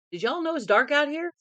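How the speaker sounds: loud call into the dark. Retold or imitated. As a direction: shout it to everyone outside. Calling out in dark.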